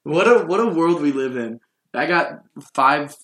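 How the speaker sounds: normal talking voice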